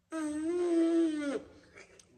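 high, loud buzz of the lips like a trumpet; lip buzz demo. Shown as a demonstration. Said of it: "the trick to blowing the trumpet is to".

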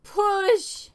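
loud high push cry; grunting yell of effort; straining shout. Real, not acted. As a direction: heave it out with a shout. Straining to free ship.

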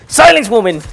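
loud, high mock tyrant bark; hammed-up villain command. Gamer to a noisy in-game woman, hammed-up tyrant shout. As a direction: boom it like a pompous villain.

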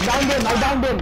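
excited shout. Real, not acted. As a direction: shout the kill out in triumph. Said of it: "I'm screaming, sorry".

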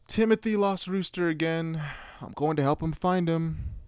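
high-pitched call through the house. A narrator's reading. Daughter to dad from the hallway, calling out. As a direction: call to someone in another room. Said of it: "I called out to my dad".

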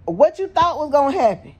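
high incredulous question; disbelieving mock question to Corey. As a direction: needle him with disbelief.

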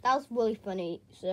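ordinary delivery

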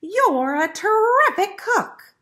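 high, slow, loud compliment; piglet voice. A narrator's reading. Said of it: "said the piglet".

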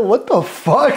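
high mock astonished outburst; disbelief. Hearing a British soldier is Dominican, high disbelieving exclamation. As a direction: sound floored by the news. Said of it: "I'm like".

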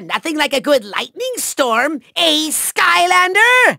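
ordinary delivery

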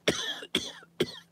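sharp cough after swallowing wrong; choking cough. Real, not acted. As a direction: cough hard, something went down wrong. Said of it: "That went down the wrong pipe".

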